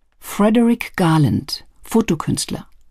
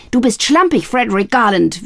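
high, loud raging accusation; 5 semitones higher, 3 dB louder than usual. A narrator's reading. Sister raging at her sloppy brother. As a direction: rage at him. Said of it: "wütete sie".

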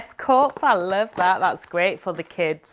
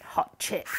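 whisper; 10 dB quieter than usual. Acted. Cheeky aside after showing accent.

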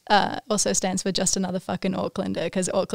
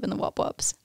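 whisper; 4 dB quieter than usual. Real, not acted. Aside about parents.

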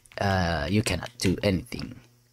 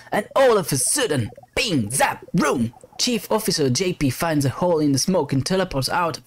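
higher, dramatic burst of action storytelling; excited narration; 7 semitones higher than usual. Acted. Captain's log as the officer teleports out, excited narration. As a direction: punch out the sound effects, then race on.

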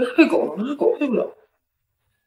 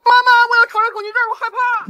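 panicky; faster than usual. Acted. Panicking before meeting rapper.

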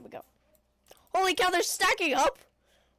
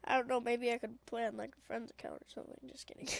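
ordinary talk

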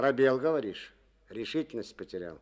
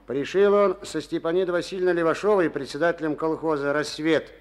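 public address; 7 semitones higher, 10 dB louder than usual. Acted. Addressing the meeting.

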